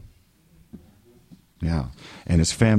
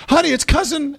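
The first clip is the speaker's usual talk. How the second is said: high, loud fake delight; mock excited; 5 dB louder than usual. Faking joy at a cousin's arrival, high and loud. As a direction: squeal the name like you're thrilled. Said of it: "you're all excited".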